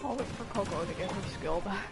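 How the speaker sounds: ordinary delivery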